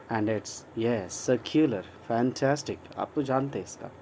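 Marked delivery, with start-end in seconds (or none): none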